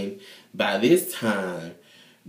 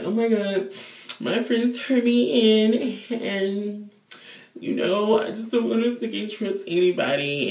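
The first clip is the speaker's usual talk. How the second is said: much higher, airy mock-whine; 11 semitones higher than usual. [name] in prison whining about friends, high mock-whine. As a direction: play the betrayed diva, high and wounded.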